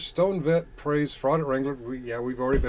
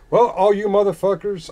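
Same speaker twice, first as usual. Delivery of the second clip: loud call-out; 7 semitones higher, 10 dB louder than usual. Real, not acted. Ranting sign-off at commenters.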